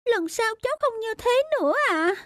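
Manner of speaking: put-on high, polite childlike sorry; a scolded girl apologizing humbly; meek little girl apology. Stepdaughter apologizes to her stepmother, meek high child voice.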